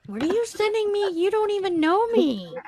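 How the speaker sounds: high-pitched retold bafflement; incredulous quoted question, high voice; baffled protest. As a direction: imitate a friend protesting, pitched well up. Retold friend's baffled, alarmed question.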